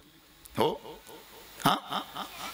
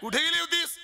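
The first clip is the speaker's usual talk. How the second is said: enraged king scolding a late servant; Ravana shouting; 12 semitones higher than usual. Retold or imitated. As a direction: yell like a furious demon king. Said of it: "Shri Ravan तिला ओरडला".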